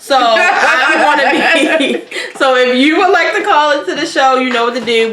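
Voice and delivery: talking through laughter; laughing. Real, not acted. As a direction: giggle while wrapping up.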